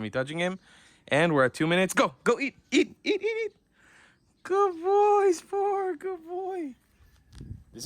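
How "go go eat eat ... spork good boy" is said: rising into very high sing-song pet talk; climbing to squeaky high praise; excited doggy praise. Real, not acted. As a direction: cheer the dog on to his food. Releasing dog to eat, praising.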